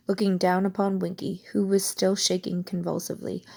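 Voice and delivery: plain reading voice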